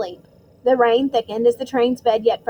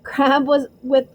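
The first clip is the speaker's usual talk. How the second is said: usual speaking voice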